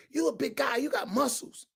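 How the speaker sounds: usual speaking voice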